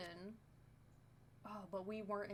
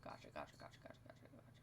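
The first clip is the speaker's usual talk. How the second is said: whisper; 10 dB quieter, faster than usual. Real, not acted. Murmuring acknowledgment to self.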